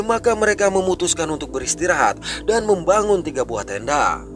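ordinary delivery, nothing marked

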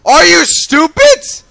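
slow, high, loud disbelief; outraged yell. Real, not acted. Gamer berating a player, outraged yell.

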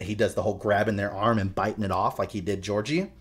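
ordinary delivery

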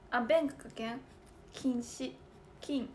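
ordinary delivery

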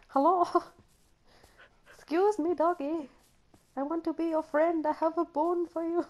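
high, sing-song coaxing of a doggy; high, sweet call to a runaway pet. Pretend. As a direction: coax the shy dog like a child. Coaxing a dog in game.

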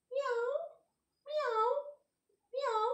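high mewing meows; cat meow. Shown as a demonstration. Teacher shows the class a cat's meow, high and mewing. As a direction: mew like a cat for the kids. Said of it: "Can you make sound like a cat?".

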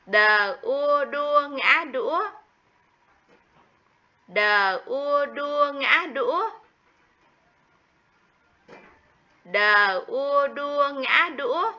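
Đờ ua đua ngã đũa spelled slowly and high, each syllable drawn out; slow spelling. Shown as a demonstration. Teacher models spelling đũa for the class, slow and high. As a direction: drag out each part of the spelling for little ones. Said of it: "Tiếng đũa".

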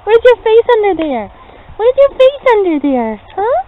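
talking to a dog. Real, not acted. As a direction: coo excitedly at the puppy.

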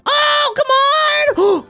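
very high, long-drawn cry; exasperated wail. Real, not acted. Kid hit by a setback in game, exasperated wail. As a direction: wail in protest, stretching it out. Mishap while playing the game.

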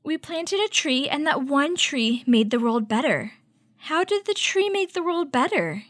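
normal talking voice

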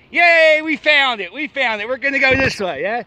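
high, loud cheer of relief; a happy cheer after a long search; excited. Real, not acted. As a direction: celebrate finding the cave. Finally finds the cave.

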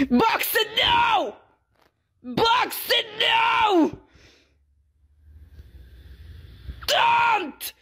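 Shrimpo. Acted. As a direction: berate a teammate at full cartoon rage. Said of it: "play as this little angry shrimp".

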